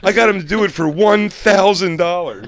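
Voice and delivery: high, loud mock outrage; indignant mock rant. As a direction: protest it loudly and high like an offended host. Mike's indignant quote.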